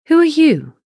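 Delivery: high, loud startled question; startled cry. Acted. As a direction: demand to know who it is, alarmed. King challenges intruding giant.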